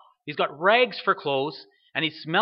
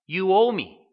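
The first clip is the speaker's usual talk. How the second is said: loud, higher accusing demand; forceful demand; 4 semitones higher, 7 dB louder, slower than usual. Retold or imitated. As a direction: hammer home the resentful son's claim, voice raised. Older son's demand, forceful repeat.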